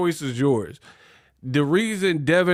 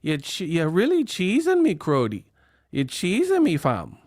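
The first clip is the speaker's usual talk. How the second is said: mock Toronto accent, higher on cheesing; Toronto slang accent; 4 semitones higher than usual.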